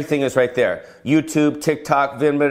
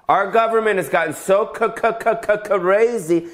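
stammering c- c- before crazy, pitched up; comic stammer, voice jumping higher; mock stutter; 7 semitones higher than usual. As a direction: fake a stuck stutter on crazy.

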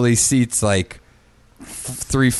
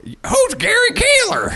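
high, slow mocking question; mock reply; slower than usual. Mocking a friend's ticket idea in a high slow voice. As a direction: toss it back high and slow, teasing.

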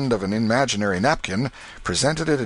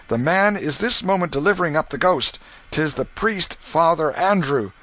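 urgent warning voice; 8 semitones higher, 4 dB louder than usual. Acted. A stranger urging Canty to flee, urgent and raised. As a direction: press him to flee before it's too late.

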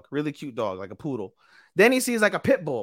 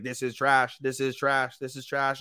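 robot voice; 7 semitones lower, 4 dB quieter than usual. Retold or imitated. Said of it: "kept thinking that this girl's trash".

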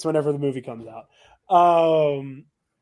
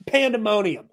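ordinary talk, nothing marked

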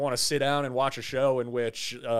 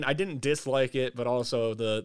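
usual speaking voice

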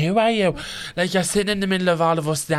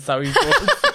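louder quote dissolving into laughter; laughing quote; 5 dB louder, much slower than usual. Retold or imitated. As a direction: quote him while cracking up. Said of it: "he says".

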